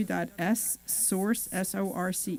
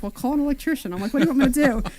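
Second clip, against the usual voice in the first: exasperated; 5 semitones higher, 6 dB louder, much faster than usual. Retold or imitated. Retells exasperated reply to husband.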